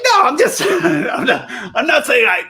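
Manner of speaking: high, laughing denial; laughing protest. Real, not acted. Defending himself to the crowd, laughing high.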